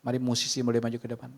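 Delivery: ordinary delivery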